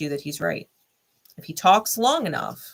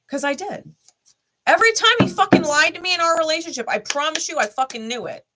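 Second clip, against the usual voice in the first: emphatic outburst; 9 semitones higher, 6 dB louder than usual. Real, not acted. Insisting she always knew.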